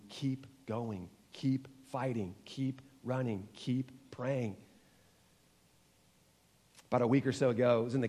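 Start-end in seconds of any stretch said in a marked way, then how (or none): 0.1-4.6 soft, slow voice of God; gentle encouragement. Retold or imitated. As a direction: whisper it like a reassurance. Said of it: "And God's telling you".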